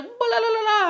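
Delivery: high-pitched mock babble; imitated yammering. Retelling the tuna cutters hoisting the fish, mock yammering. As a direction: babble nonsense up high, mimicking them. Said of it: "yammering away".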